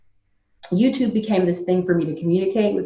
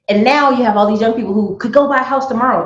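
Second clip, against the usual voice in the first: ordinary talk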